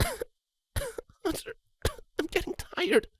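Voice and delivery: high faint filly voice; weak and tired. Acted. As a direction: fade out from exhaustion. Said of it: "Sweetie coughed".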